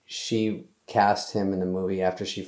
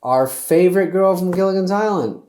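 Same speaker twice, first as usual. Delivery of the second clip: high, louder announcer read of the headline; mock headline voice; 9 semitones higher, 6 dB louder than usual. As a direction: read it like a cheesy news blurb. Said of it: "I saw in a paper".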